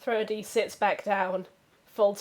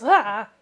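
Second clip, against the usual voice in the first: loud short squawk; flamingo cry; 8 dB louder than usual. Pretend. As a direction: blurt a bird cry. Charades, [name]'s flamingo act.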